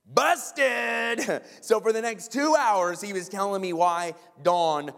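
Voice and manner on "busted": high, loud drawn-out exclamation; big comic gotcha shout; triumphant call-out. Acted. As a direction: holler it like catching someone red-handed. Punchline after being caught.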